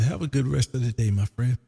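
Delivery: ordinary delivery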